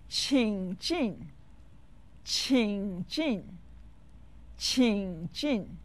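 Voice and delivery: 请进 with a low dipping third tone, then a sharp falling fourth tone. Shown as a demonstration. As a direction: say 请进: qǐng dips low, jìn falls hard. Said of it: "It's said with the third and the fourth tones".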